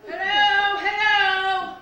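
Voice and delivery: loud hello, testing; calling out loudly. Acted. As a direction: call out to check the device. Testing hearing aid, calling out.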